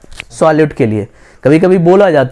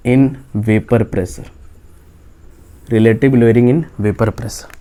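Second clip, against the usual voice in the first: slow dictation; 7 semitones lower, 4 dB quieter, much slower than usual. Real, not acted. Teacher spells out topic for notes.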